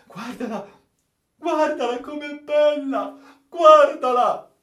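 very high cry growing loud; mad rapture. Acted. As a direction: call out in crazed delight, high and drawn out. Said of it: "come un vero pazzo".

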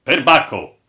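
captain's ringing exclamation; loud exclamation. A narrator's reading. Captain reacts to the strange sign, exclaiming loudly. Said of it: "esclamò il capitano".

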